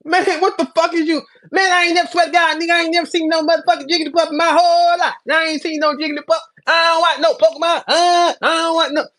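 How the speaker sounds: high, loud, crying whine; hammed-up Swamp pleading he never saw Jigglypuff; whiny mock denial. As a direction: mimic a desperate liar swearing to God. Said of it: "people getting mad 'cause it's like".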